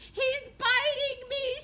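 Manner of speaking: very high, slow, loud shriek. A narrator's reading. Madeline playing with the cat, high slow shriek. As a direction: squeal in pretend terror. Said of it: "shrieked Madeline".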